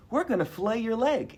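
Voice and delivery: high-pitched, smiling announcement; big smile. Retold or imitated. Young surgeon to horrified patient, big smile. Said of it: "with a big smile".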